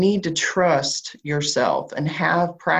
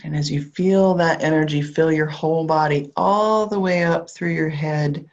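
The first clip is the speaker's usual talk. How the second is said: calm grounding visualization, unhurried; guided meditation. Acted. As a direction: guide them gently down into the body.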